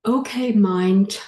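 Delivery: slow, deliberate call to the mind; slow prompt to repeat. Shown as a demonstration. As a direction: address the mind slowly, for them to repeat. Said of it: "repeat what I say".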